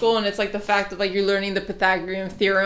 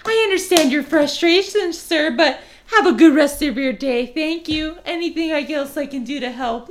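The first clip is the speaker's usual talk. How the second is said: higher, louder overly polite service voice; sweet fake politeness to a rude customer; 7 semitones higher, 6 dB louder than usual. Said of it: "You gotta have your customer service voice".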